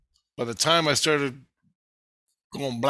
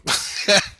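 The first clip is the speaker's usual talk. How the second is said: higher burst of laughter; real laugh; 8 semitones higher, 4 dB louder than usual. Real, not acted. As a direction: break into a laugh mid-story. Laughs while retelling.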